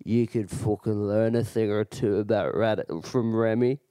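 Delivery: low imitation of a drunk Irishman; Irish accent. Drunk Irishman lecturing the storyteller, low Irish accent. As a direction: grumble it low with an Irish lilt. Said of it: "And he goes".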